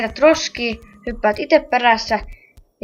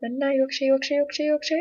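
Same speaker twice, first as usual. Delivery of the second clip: hushed but higher, hurried; tense urging; 3 semitones higher, 8 dB quieter than usual. Real, not acted. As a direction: nervously push yourself on.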